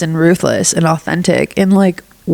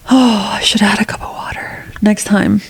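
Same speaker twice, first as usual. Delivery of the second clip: aside whisper; 2 semitones higher than usual. Real, not acted.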